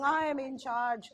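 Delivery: ordinary talk, nothing marked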